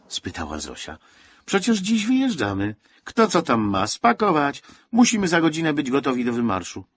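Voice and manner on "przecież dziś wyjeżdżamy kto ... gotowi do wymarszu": rallying call. Acted. Zosia rallies crying children.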